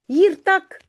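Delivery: high call to the dog. Acted. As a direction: call the dog back sharply. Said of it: "roept Jip". (Jip appelle le chien, plus aigu et plus fort)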